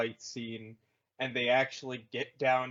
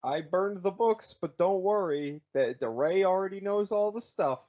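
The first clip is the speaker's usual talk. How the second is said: higher, louder mock reassurance; lightly voiced Yoda; 6 semitones higher, 6 dB louder than usual. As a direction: retell it brightly as a breezy brush-off, pitched up. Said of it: "'Cause he straight up goes".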